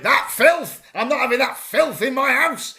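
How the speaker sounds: put-on loud, high scandalised old lady; mock outraged yell about sauce; grandmother's disgusted outburst. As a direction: bellow it like a furious grandmother. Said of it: "she used to call it".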